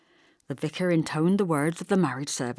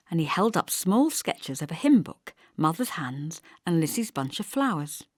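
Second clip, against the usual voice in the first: usual speaking voice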